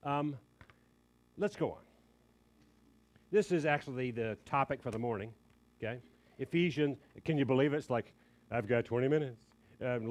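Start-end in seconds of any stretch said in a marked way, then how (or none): none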